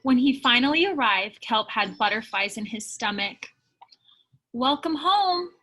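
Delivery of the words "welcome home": high, loud call rising on the name; happy greeting call. Acted. Narwhal friend welcoming Kelp home, loud and high. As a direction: call out joyfully across the water.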